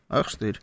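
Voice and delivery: pained mutter. Shown as a demonstration. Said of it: "когда вот мизинцем бьёшься".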